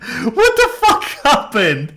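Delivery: slow, high, loud words through laughter; cracking up while asking in disbelief; laughing disbelief. Retold or imitated. Streamer laughing at someone's sudden switch, high and loud. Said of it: "and you're like".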